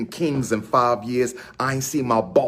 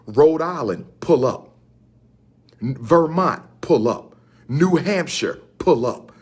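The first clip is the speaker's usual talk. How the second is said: a call-and-response roll call to the region; 2 semitones higher than usual. Real, not acted. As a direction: chant each state then pull up.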